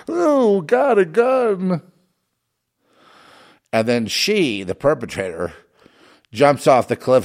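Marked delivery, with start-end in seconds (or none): 0.1-1.8 very high, slow, loud prissy falsetto gasp; mock horrified hero. Mocking the hero in the film, slow shrill falsetto. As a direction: recoil from the gun in mock horror. Mocking hero's gun fear again.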